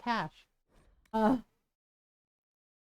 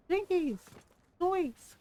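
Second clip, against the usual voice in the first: cartoon exclamations; 9 semitones higher, slower than usual. Scooby-Doo catchphrases.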